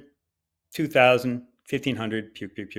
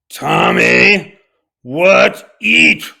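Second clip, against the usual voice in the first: put-on high loud caveman baby talk; mock caveman talk; 9 semitones higher, 14 dB louder, much slower than usual. Mocking the flight attendant by asking Tom like a caveman. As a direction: shout it like talking to a caveman.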